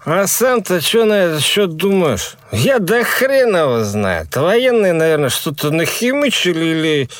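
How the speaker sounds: high, slow tipsy musing; drunk young man. Acted. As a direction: shrug it off drunkenly. Drunk friend asks and answers.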